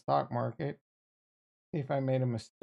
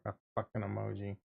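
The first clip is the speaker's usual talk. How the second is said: usual speaking voice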